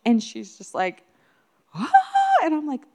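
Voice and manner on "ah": amazed gasp. Retold or imitated. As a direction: imitate a little girl gasping at a present. Retold child's gasp at gift.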